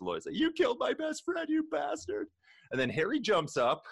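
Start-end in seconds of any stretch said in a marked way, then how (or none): 0.4-2.3 much higher, squeaky accusation; a hammed-up, wounded cry of betrayal; mock outraged Lloyd. Lloyd accuses the man who shot his best friend, squeaky and hammed up.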